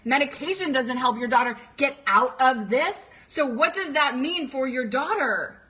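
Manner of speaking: ordinary delivery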